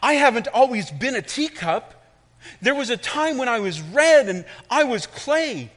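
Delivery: high, loud teacup voice; teacup telling its story. Acted. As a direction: tell it as the suffering cup. Cup narrates its story.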